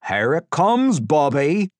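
loud, high sing-out; high, teasing call to the victim; gleeful warning. A narrator's reading. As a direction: announce it with taunting relish. Captor gleefully announces the water.

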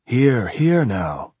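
gently chide, drawing it out; mild voice. A narrator's reading. Caretaker outside the door calming the shouter, mildly. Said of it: "a mild voice said".

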